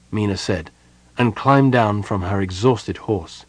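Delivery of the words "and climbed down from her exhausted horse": ordinary delivery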